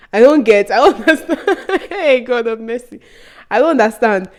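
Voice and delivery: loud, laughing disbelief; acted bafflement. Student baffled by a thief accusation, laughing disbelief. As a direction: protest helplessly through a laugh.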